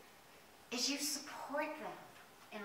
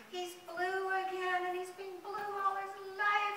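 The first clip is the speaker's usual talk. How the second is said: high, drawn-out whiny lament; mock crying; 9 semitones higher, slower than usual. A narrator's reading. Storyteller voices the iris's dismay, mock crying. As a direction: narrate it as a pretend wail. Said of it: "he starts to cry".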